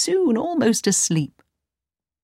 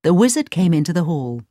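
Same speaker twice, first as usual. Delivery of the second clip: usual speaking voice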